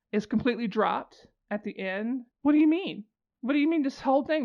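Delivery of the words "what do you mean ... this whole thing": high-pitched disbelief; incredulous protest. Real, not acted. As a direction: protest in sheer exasperation. Exasperated at dropped plot thread.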